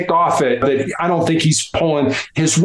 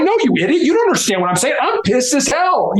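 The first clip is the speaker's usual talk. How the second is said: angry retort; 7 semitones higher, faster than usual. Retold or imitated. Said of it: "It's like".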